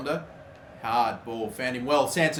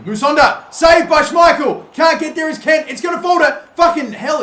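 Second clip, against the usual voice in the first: loud, high, stretched shout of disbelief; frantic near miss; 9 semitones higher, 8 dB louder than usual. Real, not acted. Gamer yelling as his shot gets saved, frantic shout. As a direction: yell as the chance is saved.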